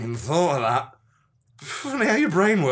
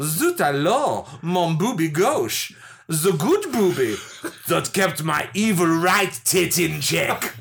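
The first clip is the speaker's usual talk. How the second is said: slow, hammy French heroine; Marine Le Pen; slower than usual. Pretend. Wounded heroine crying out, slow hammed-up French accent. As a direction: lament grandly in a comic French accent. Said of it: "Space Marine Le Pen".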